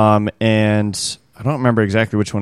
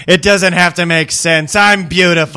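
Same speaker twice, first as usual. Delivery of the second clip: mock pop diva; 7 semitones higher, 4 dB louder, slower than usual. Mocking the singer as her.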